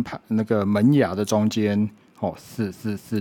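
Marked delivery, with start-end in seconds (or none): 2.4-3.2 "四" standing for a bare unvoiced th, repeated softly; short repeated th noises for "四"; isolated TH sound. Shown as a demonstration. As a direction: sound out th in place of "四", tongue between the teeth. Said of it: "四的声音".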